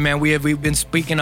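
ordinary talk

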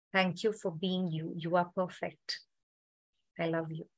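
guided meditation. Acted.

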